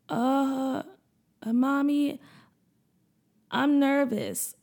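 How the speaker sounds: put-on hesitant, slow child voice; nervous little boy. Boy admits to his mom he is nervous, small and hesitant. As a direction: stammer it out like a scared kid. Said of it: "rocking back and forth nervously".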